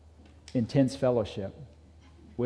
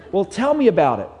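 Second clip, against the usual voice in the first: higher, louder coaxing with a laugh; wife pressing; 6 semitones higher, 6 dB louder, faster than usual. Acted. Wife urging her husband to talk, higher and louder. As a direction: push gently for the story.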